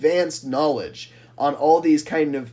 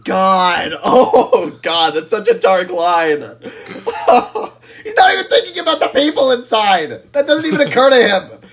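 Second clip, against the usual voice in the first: loud, high, real laughing outcry; appalled laughter; 7 semitones higher, 6 dB louder than usual. Real, not acted. Co-host reacting to a dark line, appalled laughter. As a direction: react in amused horror through laughter.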